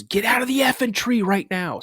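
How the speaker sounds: high, urgent retold yell; exasperated order. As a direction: imitate dad losing patience. Dad's order escalates, retold.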